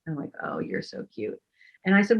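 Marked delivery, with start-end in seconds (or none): 0.4-1.4 very soft, low murmured aside; retold aside. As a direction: drop to a near-mumble for the retold reply. Retold aside at his flirting.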